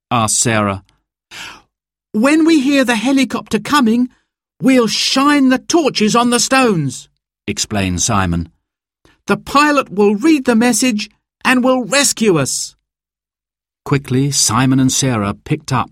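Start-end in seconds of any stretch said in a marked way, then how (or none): 2.1-7.1 put-on high-pitched, eager child's voice; eager boy explaining. A narrator's reading. Simon explains his signal plan to Sarah, eager. 9.3-12.8 put-on high-pitched, eager child's voice; eager boy explaining. A narrator's reading. Simon explains his signal plan to Sarah, eager.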